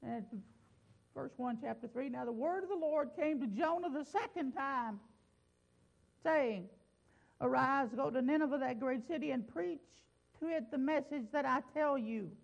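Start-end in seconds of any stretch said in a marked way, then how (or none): 2.1-12.4 raised, ringing preached reading; preaching scripture. Acted. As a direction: proclaim the prayer to the congregation. Reads scripture to congregation.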